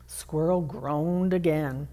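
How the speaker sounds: ordinary delivery, nothing marked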